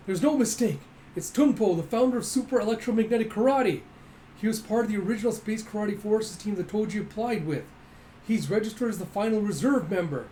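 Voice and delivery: announcing the legend's return, excited; excited announcer. Retold or imitated. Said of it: "Hopper going".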